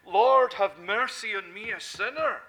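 loud pleading cry to the Lord; crying out for mercy. Retold or imitated. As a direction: call out like a desperate sinner. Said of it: "cried out and said".

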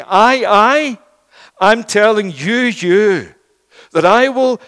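loud, emphatic sermon delivery; impassioned preaching. Real, not acted. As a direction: preach it out with weight and conviction. Preaches at full force.

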